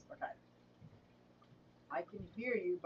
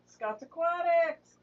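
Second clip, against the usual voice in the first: drawn-out excited greeting; 8 semitones higher, 5 dB louder, slower than usual. Real, not acted. Greeting viewers in chat.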